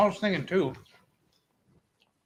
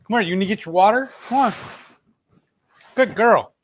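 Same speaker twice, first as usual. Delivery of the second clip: talking to dog; 6 semitones higher, 4 dB louder than usual. Real, not acted. Calling to her dog.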